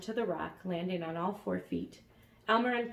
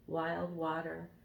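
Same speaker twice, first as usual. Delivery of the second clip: ordinary delivery, nothing marked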